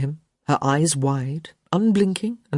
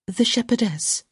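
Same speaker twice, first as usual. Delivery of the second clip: higher-pitched aside, at normal volume; explanatory; 7 semitones higher than usual. A narrator's reading. Young lady prompting her companion, explanatory. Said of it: "with an explanatory".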